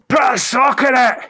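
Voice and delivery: mock Scottish shout; imitating a Scottish shout. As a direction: belt it out like an angry Glaswegian, half laughing. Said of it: "a Scottish man ... shouting".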